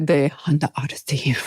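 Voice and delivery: breathy whispered car name; mock model whisper. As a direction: whisper it like a sultry car-show host. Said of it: "they're like".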